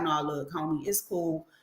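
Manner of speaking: soft, low, calming murmur; hushed reassurance to a friend; calm de-escalating. Retold or imitated. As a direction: talk her down gently. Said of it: "I'm like".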